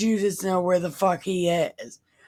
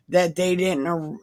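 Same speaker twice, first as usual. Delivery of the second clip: normal talking voice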